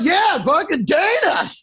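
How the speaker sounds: slow, drawn-out holler; hammed-up defiant yell; mock call-out shout. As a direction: shout the name as a joke answer. Acts out fighter calling someone out.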